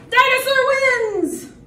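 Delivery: high, loud victory call; triumphant shout. Acted. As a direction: crow the winner to the room. Proclaims the dinosaur's victory.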